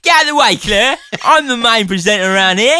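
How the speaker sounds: self-important Cockney bluster; mock Cockney. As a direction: imitate a pushy co-host in broad Cockney. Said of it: "It should have been a bit more cockney".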